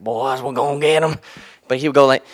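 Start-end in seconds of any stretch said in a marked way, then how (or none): 0.0-1.2 put-on higher, louder old coach rallying the boys; fired-up older coach. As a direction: imitate an older man getting fired up. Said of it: "fired up, he's an older man".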